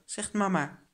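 plain reading voice

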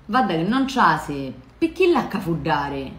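spoken in Sicilian dialect. Shown as a demonstration. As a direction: Sicilian dialect, casual everyday tone. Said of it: "poi quella tradotta in dialetto siciliano".

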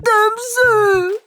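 high, drawn-out groan at the smell; disgusted at a stink. Acted. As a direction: wail slowly at an awful smell. Friend reacts to fart smell. (냄새에 괴로워 높게 늘이는 외침)

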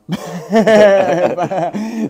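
sudden loud burst of laughter. Real, not acted. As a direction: crack up loudly at the alarm. Laughs at the alarm interruption.

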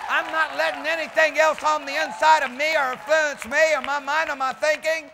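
loud, high, driving declaration; full-force preaching. Real, not acted. Preacher declaring what may influence him, shouted preaching.

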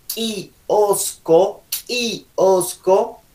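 "kiosco" lento, partido en ki-osco; K marcada. Shown as a demonstration. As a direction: haz estallar la K de "kiosco" y separa la palabra. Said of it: "Tiene que notarse la K". ("kiosco" con ka fuerte y pausada)

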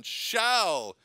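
"shall" hit hard and held long; stress on SHALL. Shown as a demonstration. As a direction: stretch out a forceful "shall". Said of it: "all these emphasis on the word shall".

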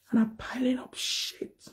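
slow, soft whisper to the camera; whispered aside. Acted. Confiding to viewers about the fraudster, whispered. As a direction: let it slip out as a secret.

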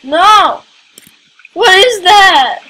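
freaked-out shouting at the screen; startled loud. Real, not acted. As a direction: panicky cries at a strange thing. Startled by a strange creature.

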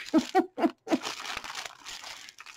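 big burst of laughter; loud laugh. Real, not acted. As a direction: laugh right into the mic. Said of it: "I probably just yelled in the camera microphone".

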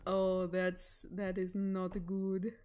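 cartoon lemur king voice, drawn out; King Julian. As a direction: put on a lemur king's accented scolding. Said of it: "King Julian voice impression".

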